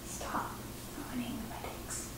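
hushed whispered scolding. Real, not acted. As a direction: whisper it sternly to the cat.

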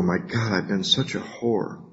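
soft, slowed mock confession; quiet mock dismay. Newcomer reading their inventory, soft mock dismay. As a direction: sigh it out as a half-hearted self-reproach. Acted self-reproach after inventory.

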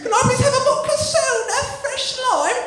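very high-pitched English order; high put-on English patron; English bar customer.

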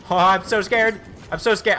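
high, loud panicked squeal; loud frightened outburst; real scared yelp. Real, not acted. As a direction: blurt it out genuinely rattled by the near-death. Startled mid-fight, says he's shaking.

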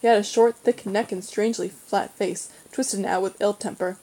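ordinary delivery

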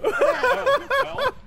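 bursting into laughter; laughing. Real, not acted. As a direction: laugh out the words, high and loud. Reacts laughing to card draw.

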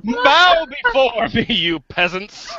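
slow, grand imperious decree; dark lord. Acted. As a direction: proclaim it like a tyrant king. Game quiz, imperious royal proclamation.

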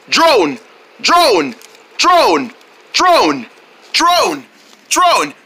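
high, punchy name chant; crowd cheering. Acted. Crowd cheers on the fighter, rhythmic chanting. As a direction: call the name over and over in falling bursts. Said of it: "They're cheering for you".

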